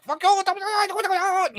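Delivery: mock sputtering businessman. Businessman sputtering at the tank, high and squeaky. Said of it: "he was like".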